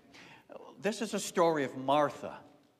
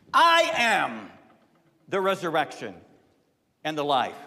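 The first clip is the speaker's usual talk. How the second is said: climactic declaration; 8 dB louder than usual. Acted. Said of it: "climactic declaration".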